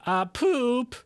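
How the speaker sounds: high, hammy Italian pizza-man voice; goofy cartoon Italian accent. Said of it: "Now you're, like, Italian".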